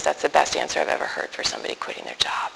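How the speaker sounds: whispered, stunned admiration; boss's hushed verdict. Retold or imitated. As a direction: breathe it out in disbelief.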